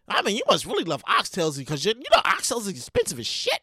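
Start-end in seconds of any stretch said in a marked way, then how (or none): none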